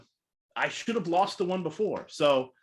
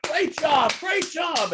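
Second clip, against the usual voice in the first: high, loud hurried praise; friend cutting in; 12 semitones higher, 4 dB louder, much slower than usual. Retold or imitated. Friend in the audience ending the speech, loud high praise. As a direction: cut her off with loud applause. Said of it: "one of her friends ... is like".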